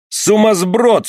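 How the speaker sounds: high, sharp angry shout; angry outburst. Acted. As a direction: snap it out in fury. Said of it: "вскричал Кернок".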